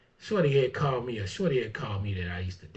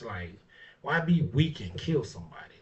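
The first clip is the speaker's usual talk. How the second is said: ordinary delivery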